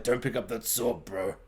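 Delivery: low, quiet aside, trailing away; low offhand quote. Retold or imitated. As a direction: imitate a guy's hushed, low warning. Quotes the guy behind Jon Snow.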